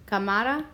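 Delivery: "cámara" as ca-MA-ra, rising; stress on middle syllable. Shown as a demonstration. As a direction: shift the weight onto MA in "cámara". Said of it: "listen to where we hear the stress".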